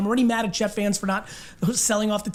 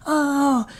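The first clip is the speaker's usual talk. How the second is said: mild mock sports-fan heckle; a playful fan's jeer, drawn out a little; 4 semitones higher, much slower than usual. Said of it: "It's not kind of fan voice like".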